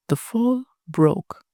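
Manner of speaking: plain reading voice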